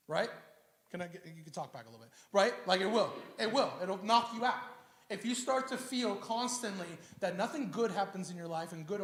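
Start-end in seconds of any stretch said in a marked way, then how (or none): none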